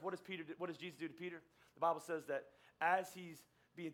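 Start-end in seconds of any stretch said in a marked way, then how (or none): none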